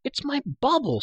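high-pitched, impatient explanation; exasperated. A narrator's reading. Girl to the amazed prisoner about her sphere, exasperated. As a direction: say it high, as if obvious.

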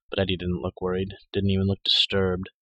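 ordinary delivery, nothing marked

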